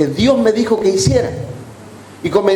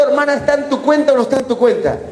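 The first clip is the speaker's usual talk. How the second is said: high, quick, exasperated retold question; comic impatient grilling; insistent questioning; 7 semitones higher, faster than usual. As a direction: press her for an answer, fast and pitched up. Pastor pressing the hesitant woman.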